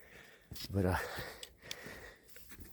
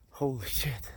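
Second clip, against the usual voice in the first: winded, whispered curse between gasps; out of breath; 4 dB quieter, slower than usual. Real, not acted. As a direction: gasp it out, breathless from the climb. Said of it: "I'm a little out of breath".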